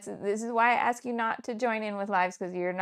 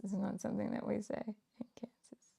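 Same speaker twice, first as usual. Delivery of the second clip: whisper; 10 dB quieter than usual. Real, not acted. Whispered aside to viewers.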